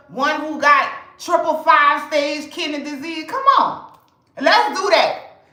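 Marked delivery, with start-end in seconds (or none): 3.3-5.4 high, loud come on; rally them to the dare; excited challenge. Real, not acted. Daring the herbalists, fired up.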